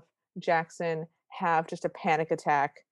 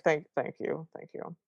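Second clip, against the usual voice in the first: gruff correction; 6 dB quieter than usual. Retold or imitated. Disguised girl corrects herself to sound like a guy, mock-manly. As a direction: drop back into a put-on guy voice. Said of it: "realizes that she's in a girl voice".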